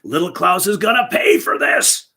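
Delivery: Big Klaus raging at home about Little Klaus; furious shout. A narrator's reading. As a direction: roar the revenge at home. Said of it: "he repeated".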